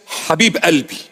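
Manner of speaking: Habib Albi in a lightly Lebanese Arabic accent. Shown as a demonstration. Preacher showing the Lebanese Arabic phrase, lightly accented. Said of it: "Arabic Lebanese accent".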